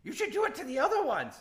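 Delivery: very high, gleeful troll squeal; high-pitched, overjoyed creature voice; excited troll. Acted. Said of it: "the troll gets really excited and jumps and goes".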